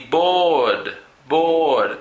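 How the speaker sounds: "board" with a long held aw, falling; long vowel demo. Shown as a demonstration. Teacher models a longer sound in board, long vowel demo. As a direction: stretch the vowel in each "board". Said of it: "un sonido más largo".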